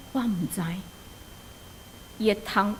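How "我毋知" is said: soft, hopeless little voice; very quietly. Acted. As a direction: barely say it, defeated. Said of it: "非常细声讲".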